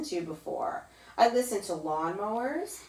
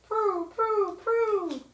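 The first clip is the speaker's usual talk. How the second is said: high cooing pigeon voice; 13 semitones higher, 4 dB louder, much slower than usual. Retold or imitated. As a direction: coo it high like a pigeon asking for food. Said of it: "They say".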